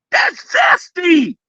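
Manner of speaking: mocking shout. Real, not acted. Calling the crying podcaster out with a slow mocking shout. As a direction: drag out zesty like a verdict.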